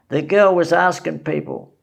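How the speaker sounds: usual speaking voice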